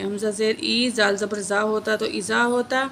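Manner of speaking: ordinary delivery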